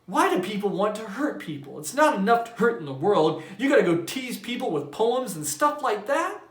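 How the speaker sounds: high, loud indignant; upset complaint. A narrator's reading. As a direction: rant in outrage. Bowles ranting on.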